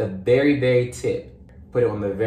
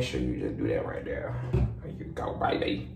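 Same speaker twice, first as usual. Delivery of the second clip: soft cooing; 8 dB quieter than usual. Pretend. Talks softly to her contacts.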